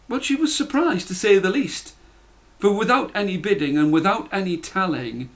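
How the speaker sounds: usual speaking voice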